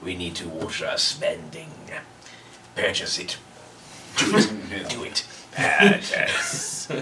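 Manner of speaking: low whispered Sith emperor voice; bad acting. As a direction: hiss it like a hammy dark lord. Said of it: "Worst acting ever".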